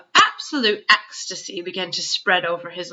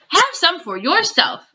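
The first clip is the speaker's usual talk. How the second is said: higher, eager giant offer; 11 semitones higher than usual. A narrator's reading. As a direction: push it on her gladly. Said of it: "cried the B F G".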